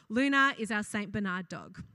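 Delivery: normal talking voice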